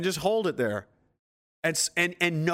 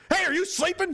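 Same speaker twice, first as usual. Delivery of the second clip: high, sudden call to a dozing comrade; scolding lookout. Acted. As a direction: catch someone napping, pitched way up. Leader calling out to sleeper.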